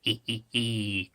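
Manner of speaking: low soft sneaky hee hee; sly bandit snicker. Acted. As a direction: snicker like a hidden villain. Imagined cat bandits.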